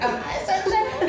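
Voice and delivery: high, laughing disbelief; surprised. Retold or imitated. Guest answering the flirty waiter, high and surprised. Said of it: "And I'm like".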